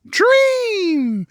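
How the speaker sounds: high sustained "dream", sliding down at the end; drawn-out exclamation. Real, not acted. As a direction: hold the word high and let it float down. Repeats the signature drawn-out cry.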